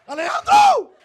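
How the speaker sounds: very high, loud wail of the rival's name; anguished name scream. Retold or imitated. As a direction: howl it in jealous defeat.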